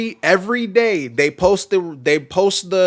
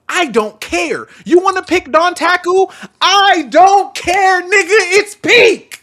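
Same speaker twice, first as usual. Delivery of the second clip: loud, higher heated insistence; heated rant; 9 semitones higher, 5 dB louder than usual. Real, not acted. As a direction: pound the point home, louder each time. Heated rant about best match.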